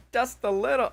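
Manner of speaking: high-pitched, struggling Scottish accent; badly faked Scottish lilt; attempted Scottish accent. Shown as a demonstration. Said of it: "but trying to say".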